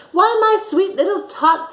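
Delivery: high, loud sugary fake Santa; a sly Grinch playing kindly Santa; fake Santa sweetness. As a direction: lay the fake charm on thick. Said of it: "that fake Santa Claus lied".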